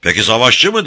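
loud, high rhetorical question; Beşvayo. A narrator's reading. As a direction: ask it up to the sky. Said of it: "diye sordu gökyüzüne". (gökyüzüne seslenir gibi sorarak)

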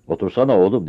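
booming order to sit; roaring. Acted. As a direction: bellow it out. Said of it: "diye gürledi".

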